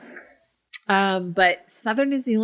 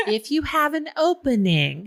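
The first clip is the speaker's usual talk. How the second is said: put-on higher, coaxing Southern accent; put-on Southern receptionist voice; indistinct Southern accent; 7 semitones higher than usual. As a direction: ask a favor in a lilting Southern accent. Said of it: "not my Tennessee family's accent".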